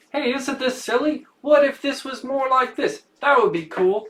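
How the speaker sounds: high, loud, hammed-up English accent; terrible English accent. Imitating an upbeat comedian to the co-host, in a terrible English accent. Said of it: "That's a terrible English accent".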